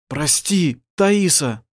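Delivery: ordinary delivery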